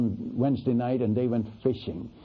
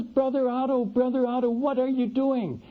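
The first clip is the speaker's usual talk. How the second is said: surprised calling out; 11 semitones higher than usual. Retold or imitated. Pastor spots him hitchhiking.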